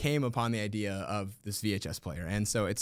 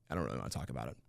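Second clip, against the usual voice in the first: much lower and softer, sulking; mock sulky mumble; 11 semitones lower, 11 dB quieter, much faster than usual. Teacher to students, faking a hurt sulk, low and soft. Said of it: "He goes".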